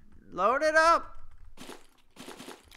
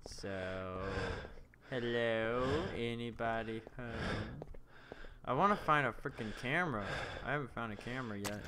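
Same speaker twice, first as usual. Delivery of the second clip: ordinary talk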